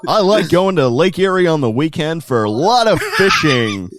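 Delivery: loud, high upstate American voice; American accent. Pretend. Australian hamming a Lake Erie accent for his American hosts. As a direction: play a proud Lake Erie local. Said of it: "He sounds like he's from Lake Erie".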